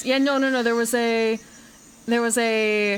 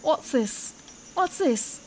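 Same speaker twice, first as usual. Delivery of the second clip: a higher put-on British voice; accidental British; 5 semitones higher, much slower than usual. Streamer voicing the character, comes out British. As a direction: ask it in a mock British accent. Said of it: "That's fucking British".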